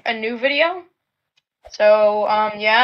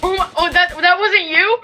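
ordinary talk, nothing marked

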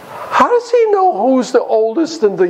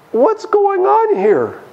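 high, slow bewildered cry; bewildered brothers; 11 semitones higher, slower than usual. Acted. Brothers baffled by the invitation to Joseph's house, bewildered. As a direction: draw it out high in confusion.

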